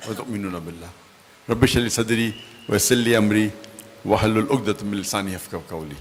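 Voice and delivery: soft, low Arabic recitation; Arabic opening invocation. Acted. Preacher opens his address to the congregation, softly reciting. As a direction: recite the opening prayer quietly, in a low murmur.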